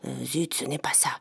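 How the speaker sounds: hushed annoyed whisper; whispered aside. Acted. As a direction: mutter it under your breath. Aside after a mistake.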